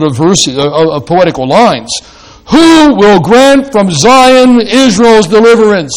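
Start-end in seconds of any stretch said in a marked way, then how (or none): 2.5-5.9 raised, louder ardent reading; ardent plea. Shown as a demonstration. Preacher reading the psalm aloud to the congregation, raised and ardent. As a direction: cry out the question as a longing plea. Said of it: "make it a kind of ardent prayer wish".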